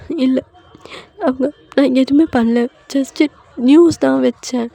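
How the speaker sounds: high, loud tearful protest; acted weepy wail, slowing at the end; crying. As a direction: plead innocence, upset. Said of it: "இப்ப அழாத".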